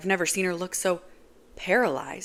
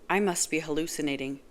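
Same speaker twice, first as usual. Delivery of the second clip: plain reading voice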